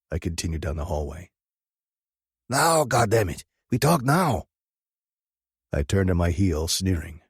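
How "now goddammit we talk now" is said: high, loud angry demand. A narrator's reading. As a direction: insist hard, no waiting. Dodge demanding to talk.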